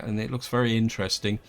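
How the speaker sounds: ordinary delivery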